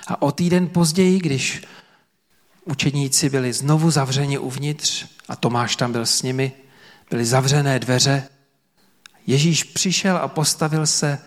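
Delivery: ordinary talk, nothing marked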